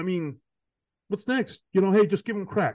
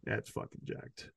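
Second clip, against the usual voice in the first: muttered aside; 6 semitones lower, 16 dB quieter, slower than usual. Real, not acted.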